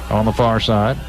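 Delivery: normal talking voice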